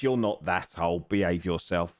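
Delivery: ordinary delivery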